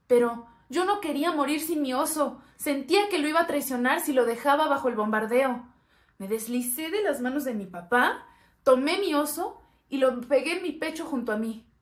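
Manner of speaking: put-on desperate child. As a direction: tell it as a child desperate to save a toy. Child desperate to save bear.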